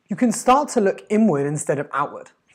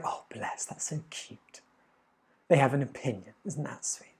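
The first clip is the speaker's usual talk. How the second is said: softer, breathy, patronising coo; mock sweet whisper; 3 semitones lower, 10 dB quieter than usual. Brushing off someone's bad opinion with mock sweetness. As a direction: pat the critic on the head with your voice.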